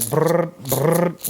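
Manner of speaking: buzzy lip-trilled "brrr" in short bursts; quick rolled "brrr" buzz; rattling vibration noise. Shown as a demonstration. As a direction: imitate a lure's rattle, brrr, brrr. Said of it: "that vibration".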